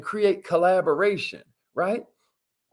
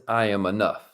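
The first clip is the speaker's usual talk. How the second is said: affirmation; 8 semitones lower than usual. Shown as a demonstration. Host repeating the affirmation to viewers, low and earnest. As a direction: say it like you mean it. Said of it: "Y'all know this".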